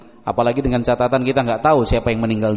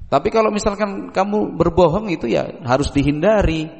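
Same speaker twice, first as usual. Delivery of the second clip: normal talking voice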